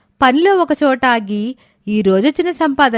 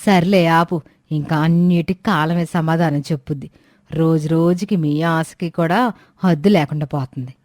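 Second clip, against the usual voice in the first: put-on lower-pitched old woman, complaining; old woman Suramma; 7 semitones lower than usual. Old woman scolding the late milk seller, lower grumbling voice. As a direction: grumble like an impatient old granny.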